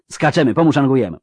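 loud, high command; urgent shout. A narrator's reading. Geralt again ordering the escape, shouting urgently. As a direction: shout the order, pressing hard. Witcher repeats order under fire.

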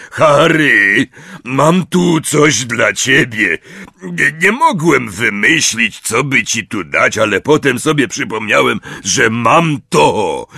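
a big friendly giant reminiscing; Hagrid's voice. A narrator's reading. Hagrid to Harry, fondly remembering.

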